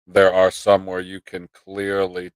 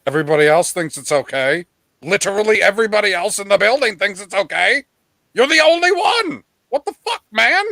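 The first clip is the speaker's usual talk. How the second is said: exasperated rant; 9 semitones higher, 5 dB louder than usual. Retold or imitated. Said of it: "And I was like".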